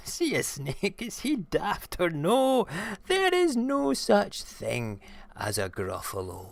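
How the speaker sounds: high mocking mouse voice; gleeful mouse. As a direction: gloat after a giggle. Mouse mocks the fled snake.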